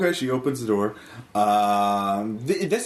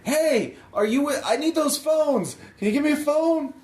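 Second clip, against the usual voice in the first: pleading woman, pitched way up; high woman's voice. Acted. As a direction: raise the voice high and urgent.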